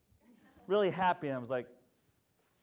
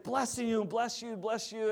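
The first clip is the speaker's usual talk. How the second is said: grateful woman; 7 semitones higher than usual. Retold or imitated. Crying woman retold.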